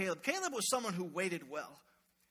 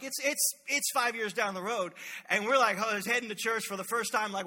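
ordinary delivery

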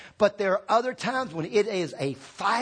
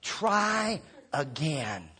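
slow emphatic call; 4 semitones lower, 4 dB quieter, much slower than usual. Shown as a demonstration. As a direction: stretch out the words try again, slow and deliberate. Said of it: "everybody say".

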